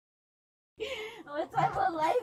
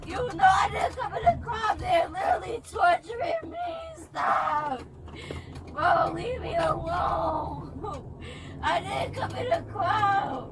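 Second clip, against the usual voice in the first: crying yell; 2 semitones higher than usual. Real, not acted. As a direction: yell your innocence through tears. Arrested woman yelling through tears.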